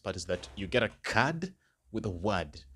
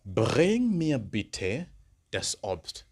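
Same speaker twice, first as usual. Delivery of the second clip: slow, raised start on Bring; slow model request; 2 semitones higher, 5 dB louder, much slower than usual. Shown as a demonstration. Teacher modelling a request for learners, slow and raised. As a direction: open loud and slow, then settle. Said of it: "the second request I could give".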